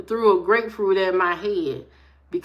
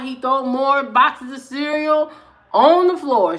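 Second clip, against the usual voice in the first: normal talking voice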